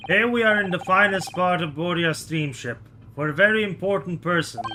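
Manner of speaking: put-on lightly Russian-accented guard, proud; a hint of a Russian accent, boasting; mock Russian guard showing off; Russian sailor voice.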